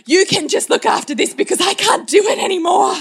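very high, hammed-up wail; mock tantrum. Mother throwing herself down before her kids, mock tantrum. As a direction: give up theatrically at a high pitch. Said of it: "have a tantrum".

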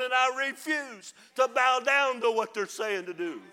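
father preaching his legacy at full force. Acted. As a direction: stand and declare it to the church. Preacher's final stand, loud.

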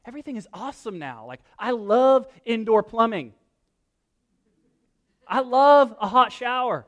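high, loud disbelief; high, amazed incredulity; incredulous. Retold or imitated. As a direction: play up how baffled you are. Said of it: "puzzlingly look at them and like".

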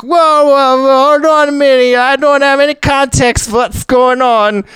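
very high, hammed-up German accent; loud German inventor. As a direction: interrupt shrilly in a mad-scientist German accent. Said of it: "decided to give him a German accent".

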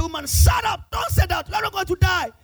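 stern shout. Retold or imitated. Pastor shouting down a panicking woman in turbulence, stern shout. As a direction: bark it to silence her panic.

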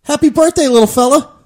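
loud, high birthday greeting; cheery greeting. Real, not acted. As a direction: greet him big and bright on the air. Greeting birthday man on air.

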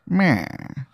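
hammed-up twenties private eye; 1920s detective. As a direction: vow revenge like an old movie detective. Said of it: "he talks like one too".

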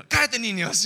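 high, loud Spanish scolding in a put-on voice; hammed-up abuela telling the kids to hush; scolding grandma. Pastor imitates grandma silencing the children, loud and high. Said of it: "I'd be like".

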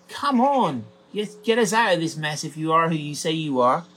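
high and loud, scornful; a jeer thrown at Jesus; mocking taunt. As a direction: goad him, daring him to prove it. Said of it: "he's like, kind of like".

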